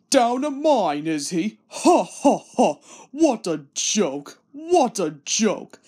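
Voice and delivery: big booming laugh through the words; laughing loudly. Acted. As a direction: roar with laughter at the news. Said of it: "he laughed very loudly".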